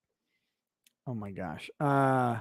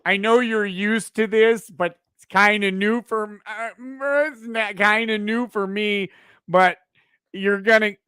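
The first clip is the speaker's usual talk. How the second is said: higher, louder Wisconsin accent, hammed up; 4 semitones higher, 3 dB louder than usual. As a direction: put on a goofy Wisconsin accent. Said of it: "hear you in a Wisconsin accent imitating Richards".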